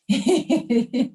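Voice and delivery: short bright burst of giggles; laugh. Real, not acted. Laughs after joking about pretending.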